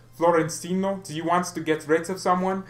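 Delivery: mock German accent. Vlogger acting the Bayern board phoning Madrid, German accent. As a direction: ask it in a hammed-up German accent. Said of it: "And in the German accent".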